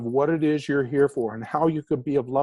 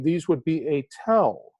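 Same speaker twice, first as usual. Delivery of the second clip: ordinary delivery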